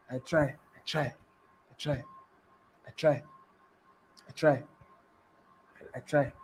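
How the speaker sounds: low, soft, repeated aside; quiet self-congratulation. Acted. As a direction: say it low and softly, pausing between repeats. Answers client's complaint with modest boast.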